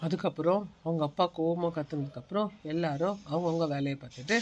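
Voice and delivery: a narrator quietly telling what happened next; story narration. A narrator's reading. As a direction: narrate calmly, setting the night scene.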